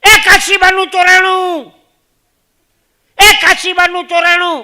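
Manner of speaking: very loud, high, slow drawn-out shout; shouting alarm. Retold or imitated. A passenger shouting alarm about Kashiba in a train. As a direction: bellow it across the train carriage. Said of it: "એક જણા ઓચિંતાની બૂમ પાડી".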